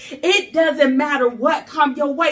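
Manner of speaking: usual speaking voice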